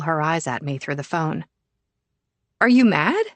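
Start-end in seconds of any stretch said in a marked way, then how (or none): 2.6-3.2 put-on tentative teen question. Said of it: "she asks after a second".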